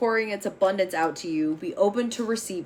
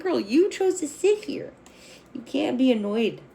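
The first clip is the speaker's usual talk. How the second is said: sassy scolding cat; 4 semitones higher than usual. Retold or imitated. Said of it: "And it's like".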